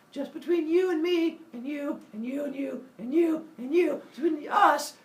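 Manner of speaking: high, hammed-up gossip voice; high and insistent, adding listener after listener; gossip spreading secret.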